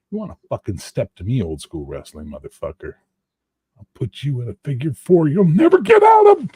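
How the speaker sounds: wrestler trash talk. Pretend. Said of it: "The Rock impersonation".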